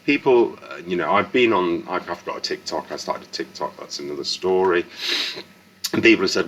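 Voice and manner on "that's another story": ordinary delivery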